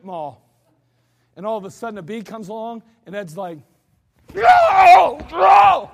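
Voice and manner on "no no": very loud, high terrified cry; panicked scream. Retold or imitated. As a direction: scream it like a bee is on you. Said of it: "Ed's freaking out".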